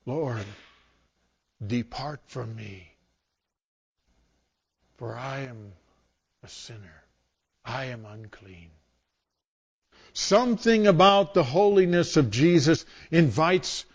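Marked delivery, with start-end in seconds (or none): 0.1-8.7 much softer, fading to almost nothing; hushed confession. Acted. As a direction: confess it under your breath in shame. Peter's confession to Jesus.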